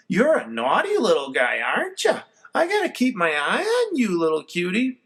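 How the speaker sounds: lightly voiced fond scolding; mother cooing at the naughty puppy; mom baby talk. A narrator's reading.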